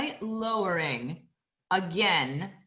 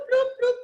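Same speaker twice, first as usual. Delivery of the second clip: higher pulsed bloop sounds; pulsing bloops; 13 semitones higher, 5 dB louder than usual. Shown as a demonstration. Teacher demonstrating pelvic floor pulses, high bloops. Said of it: "a little pelvic floor bloop".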